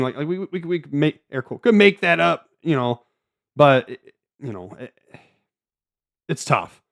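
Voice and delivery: halting, repeating words; stammering. Real, not acted. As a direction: stumble over the words while searching. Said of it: "I'm stammering a bit".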